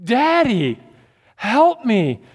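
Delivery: lightly voiced panicked toddler; little child screaming. A father retelling his drowning son's cries, mild mock childish yell. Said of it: "screaming in his little child voice".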